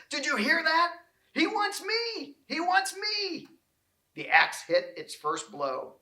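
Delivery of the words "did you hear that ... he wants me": much higher, slow, delighted cries; thrilled. A narrator's reading. Little Tree telling his friends he was picked, in high overjoyed cries. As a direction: burst with joy at being chosen. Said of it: "Little Tree cried to his friends".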